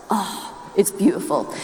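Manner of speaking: normal talking voice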